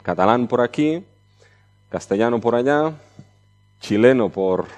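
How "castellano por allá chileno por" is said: normal talking voice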